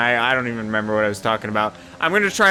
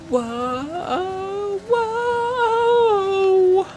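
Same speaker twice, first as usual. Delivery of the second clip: long, much higher drawn-out whoa; a high, stretched cry of alarm; startled whoa. Real, not acted. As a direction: yelp in shock as the car slides. Near spin in race.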